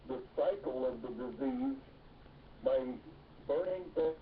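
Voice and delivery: ordinary delivery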